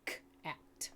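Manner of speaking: k and at pulled apart, whispered; segmented sounds. Shown as a demonstration. Teacher segmenting cat for students, whispered sounds. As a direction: whisper each sound separately. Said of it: "segment it into its separate sounds".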